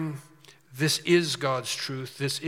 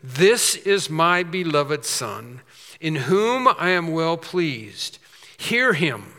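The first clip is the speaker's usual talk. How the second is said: loud, slow proclamation as God; voice from the cloud; 3 semitones higher, 7 dB louder, slower than usual. Acted. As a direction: declare it slowly and loudly as God speaking from heaven. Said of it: "a voice came out of the cloud saying".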